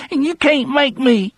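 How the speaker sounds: put-on high whiny child, stress on can't; a frightened child digging in; childish, trembling. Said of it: "in a childish, trembling voice".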